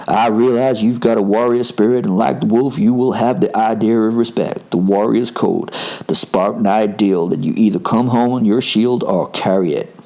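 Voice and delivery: put-on American-accented challenger, steady and level; attempted American accent. Quentin challenging Will to a fight, attempted American accent. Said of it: "I'm gonna attempt an American accent".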